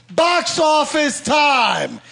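slow drawn-out game show call; announcer voice. Acted. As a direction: announce it like a host, stretched. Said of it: "announcing segment".

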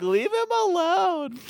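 high-pitched, drawn-out imitated scolding; mock protest. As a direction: call out slowly in exaggerated defence of a kid. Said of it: "they're like".

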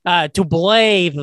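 "blathe" said slowly with a lisp; mock lisp. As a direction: slowly stretch "blathe" like the clergyman. Quoting the clergyman's word.

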